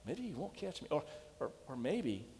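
put-on a child's worried inner voice; fearful doubt. Boy's fearful private doubts.

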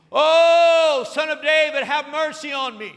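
high, loud wailing plea. Retold or imitated. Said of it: "it gets louder and louder".

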